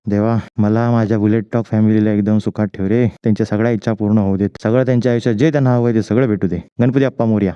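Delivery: low, level praying voice; prayer. Real, not acted. Vlogger asking Ganpati to bless his followers, low prayer. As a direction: pray earnestly on a low, even note. Prays at the temple.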